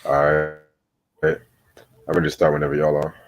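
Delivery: ordinary talk, nothing marked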